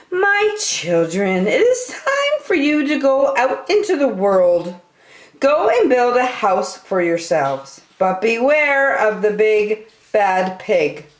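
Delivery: put-on a mother wolf warning her children. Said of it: "the mother called the three little wolves around her and said".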